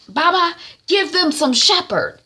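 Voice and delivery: high, loud sneering order; wife's mocking order. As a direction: tell Baba to deal with them. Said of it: "That's what she's saying".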